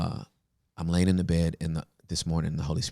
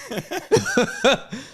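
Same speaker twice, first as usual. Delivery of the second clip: high-pitched burst of laughter; real high laugh; 4 dB louder than usual. Real, not acted. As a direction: let out a squealing laugh. Laughs after punchline.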